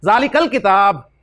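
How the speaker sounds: जालिकल किताब said slowly, with काफ joined to लाम; teacher modelling the joined reading; slow correct reading. Shown as a demonstration. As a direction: drawl जालिकल किताब so the link can be heard. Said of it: "बहुत अहम बात".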